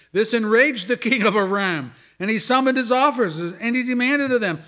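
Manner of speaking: reads the scripture loud and high, voicing the angry king; full-force preaching. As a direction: proclaim it from the pulpit at full force.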